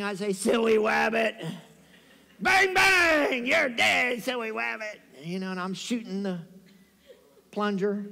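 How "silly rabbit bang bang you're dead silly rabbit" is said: cartoon hunter accent, slow start, shouted bangs; hammed-up cartoon rabbit hunter; Elmer Fudd. Blindfolded pledge shooting his induction mate with a plunger, cartoonish. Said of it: "they made me do the accent".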